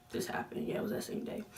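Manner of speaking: unvoiced, soft self-correction; whispered self-check; whispered to herself. Real, not acted. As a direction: mutter it under your breath.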